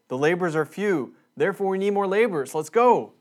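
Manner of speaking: rallying call to action; pep-talk push to get people going; rallying exhortation. Retold or imitated. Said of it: "as an exhortation to call people".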